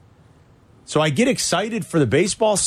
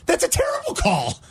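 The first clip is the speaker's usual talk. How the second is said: mock outrage; 7 semitones higher than usual. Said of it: "I was livid".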